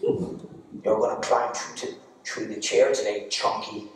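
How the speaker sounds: low, quiet, slow scheming; little evil genius. Acted. Brother plotting at school as cartel boss, evil genius. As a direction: give orders like a small mastermind. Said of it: "like a little evil genius".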